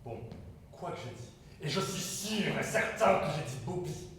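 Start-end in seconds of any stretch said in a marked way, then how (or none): 1.6-4.1 loud, slow, emphatic assertion; louder and higher, dead certain; indignant insistence. Acted. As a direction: raise your voice and insist on every word. Insists after being contradicted.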